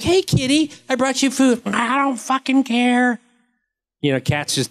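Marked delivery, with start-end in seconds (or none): 0.0-1.6 much higher, sing-song call to a kitty; coaxing cat owner. Retold or imitated. 1.7-3.2 high, slow, disdainful cat; bored cat voice. Retold or imitated. Voicing the bored fed cat.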